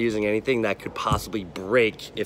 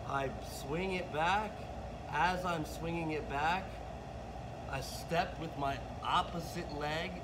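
distant from the mic, much softer; far away; 3 semitones higher, 10 dB quieter than usual. Real, not acted. Coach demonstrating bowling from down the lane, far off. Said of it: "that was a little bit far away".

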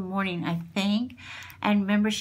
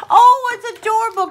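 laughing; 11 semitones higher, 10 dB louder, faster than usual. Real, not acted. Laughing through delight.

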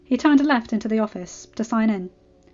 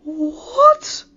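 high-pitched, shocked outburst; stunned disbelief; 14 semitones higher, 5 dB louder than usual. A narrator's reading. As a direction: blurt it out, jaw dropped. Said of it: "Flash's mouth dropped open".

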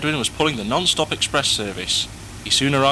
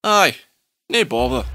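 put-on broad Scottish accent. Douglas says Aye.

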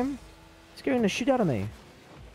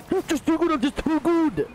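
bragging boast; 11 semitones higher than usual. Acted. Streamer to opponent after a save, cocky high brag. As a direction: crow about how good you are.

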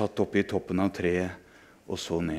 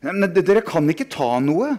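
higher, louder stammering shout; 7 semitones higher, 6 dB louder than usual. Acted. A boy shouts at friends to stop, in alarm. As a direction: cry out to stop the boys. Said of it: "ropte jeg".

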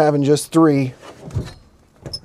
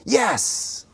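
excited; 7 semitones higher, 5 dB quieter than usual. Real, not acted. Finds three more screws.

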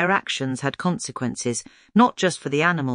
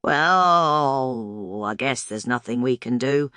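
pretend defeat; 3 semitones lower, slower than usual. A narrator's reading. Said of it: "Tilly pretended to be defeated".

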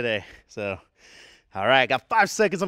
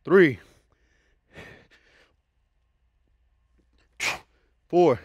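calling out reps; much slower than usual. Real, not acted. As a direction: call each rep out firmly. Counting reps during a workout.

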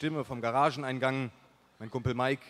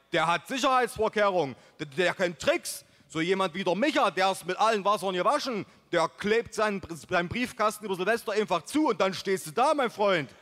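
loud, higher put-on zealot; worked-up buddy; 6 semitones higher, 5 dB louder than usual. A narrator's reading. Buddy explaining his rival's cunning tricks, worked-up loud voice. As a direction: rant with growing zeal about the enemy. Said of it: "eiferte sich Mike".

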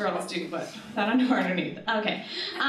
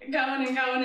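ordinary talk, nothing marked

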